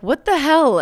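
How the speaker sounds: high, loud "what the hell"; shocked shout. Retold or imitated. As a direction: yell it in disbelief. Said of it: "Needy is like".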